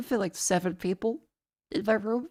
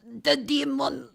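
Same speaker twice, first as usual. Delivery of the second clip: imitating Greek accent; 4 semitones higher, 5 dB louder than usual. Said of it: "Hang on, Mister Constantinopoulos".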